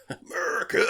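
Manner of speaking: high, loud belted cry; hammed-up American rallying cry; mock patriotic shout. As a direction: belt it like a parody anthem. Hosts mimic patriotic film song.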